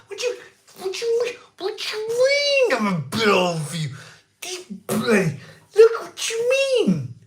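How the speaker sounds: high, squeaky, hammed-up indignation; comic falsetto fury over a bit old for you; mock outrage. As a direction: shriek it like you're wounded and offended. Mock outrage at being called old.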